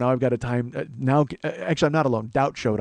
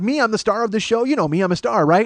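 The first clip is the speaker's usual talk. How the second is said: mock vain star; 7 semitones higher, 5 dB louder than usual. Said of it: "so stupid me".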